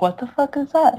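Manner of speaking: high, squeaky confused question; baffled reader. Retold or imitated. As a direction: play the lost reader.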